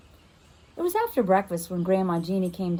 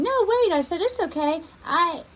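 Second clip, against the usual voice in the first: high, louder pleading call; urgent plea; 9 semitones higher, 4 dB louder than usual. Acted. Girl begs her friend to stay, high and urgent. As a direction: stop her from leaving.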